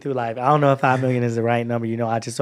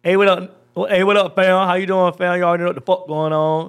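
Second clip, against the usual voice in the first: higher, punchy street-style shout-out to fam; Marquise; 4 semitones higher, 4 dB louder than usual. Acted.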